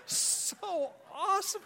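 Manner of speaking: fake enthusiasm. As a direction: gush falsely over her success. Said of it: "And I'm just sitting there going".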